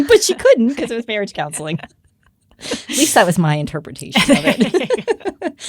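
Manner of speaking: amused, words riding on laughter; laughing. Real, not acted. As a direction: laugh your way through the explanation. Laughing at her own joke.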